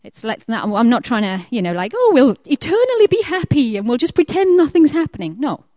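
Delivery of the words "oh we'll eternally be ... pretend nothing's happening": higher, sing-song false happiness; mock cheerfulness. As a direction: chirp it in pretend bliss. Mocking a falsely happy attitude.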